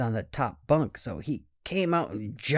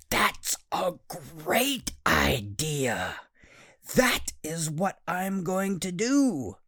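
put-on excited little boy; 5 semitones higher, much slower than usual. Boy voiced in a bedtime story.

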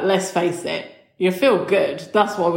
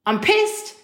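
real annoyed exclamation, voice shooting up; exasperated outburst; 13 semitones higher than usual. Real, not acted. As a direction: pitch jumps much higher on pissed, rising.

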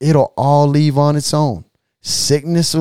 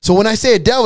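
usual speaking voice

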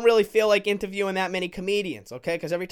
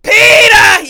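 full-throated yell, much higher and louder; hollering at the field for the old Peter; screaming for Peter; 19 dB louder, slower than usual. Retold or imitated. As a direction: bellow his name like a furious fan. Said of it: "You gotta fucking be screaming out there".

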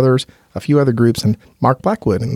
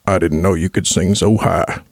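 low, measured father's voice; bass-singer dad; 5 semitones lower than usual. Retold or imitated. Quoting his bass-singer father's reaction, in a low dad voice. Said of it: "my dad is a bass singer".

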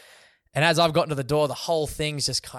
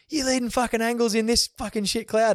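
higher, indignant mock telling-off; mock the instructor's outrage in a higher voice; imitating the angry instructor; 9 semitones higher, 3 dB louder than usual. Instructor confronts him after landing.

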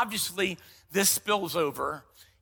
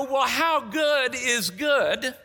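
incredulous patient; 5 semitones higher, 3 dB louder, slower than usual. Retold or imitated. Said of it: "And I go".

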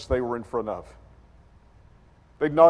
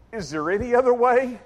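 higher-pitched pleading to a father; anguished prayer; 7 semitones higher, 3 dB louder than usual. Retold or imitated. Jesus praying to his father under stress, pleading. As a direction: plead as a son to his dad. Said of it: "he prays to his father".

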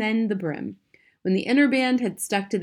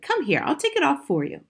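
lightly voiced bossy wife, pitched up; 7 semitones higher than usual. A narrator's reading. Wife offering to pull off the hat, bossy. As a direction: take charge as the wife, higher voice. Wife takes charge of husband.

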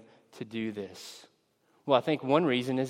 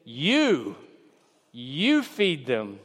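YOU drawn out and stressed, then a pause; emphatic command; much slower than usual. Acted. Jesus answering his disciples' request, emphatic stress on "You". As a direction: lean hard on "You", slowly repeating it as an order. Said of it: "emphatically, he says".

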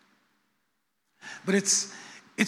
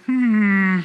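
a loud musing hmm; loud pondering hum; 7 dB louder than usual. Retold or imitated. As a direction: hum it out as if struck by a thought. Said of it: "And I was like".

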